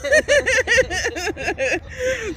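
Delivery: high, loud burst of laughter. Real, not acted. Laughing after greeting.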